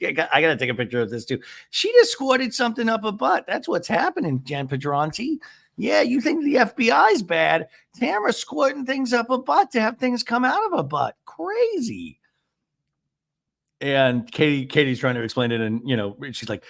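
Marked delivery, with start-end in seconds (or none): 1.7-12.2 high-pitched mock explanation; Gina. Recapper mimics a housewife telling a newcomer, high mock indignation. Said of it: "And Jean's like".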